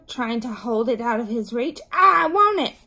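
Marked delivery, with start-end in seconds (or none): 1.9-2.8 high, loud tantrum cry; shrieking insistence; screamed demand. A narrator's reading. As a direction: demand it at the top of a child's voice. Screaming child fights over lollipop.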